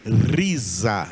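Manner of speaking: risa with a rolled r, for the class to repeat; model for repeating. Shown as a demonstration. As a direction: say risa clearly so they can copy. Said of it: "Repita aí".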